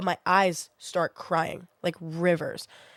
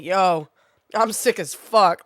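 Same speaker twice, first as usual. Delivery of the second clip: loud, slowed-down declaration; emphatic announcement; 6 dB louder, much slower than usual. Retold or imitated. Texting family about being sick, loud emphatic announcement. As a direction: announce it loudly, drawing out the words. Acts out blunt text to family.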